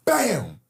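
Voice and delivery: sharp high bang; gunshot. Retold or imitated. Recounting the shot at three, sharp bang. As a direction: burst out like a gunshot.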